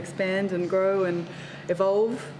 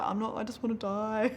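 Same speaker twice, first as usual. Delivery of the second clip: soft, higher mock despair; retold crying; 4 semitones higher, 4 dB quieter than usual. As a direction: lightly act out tearful misery. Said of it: "I was just crying, like".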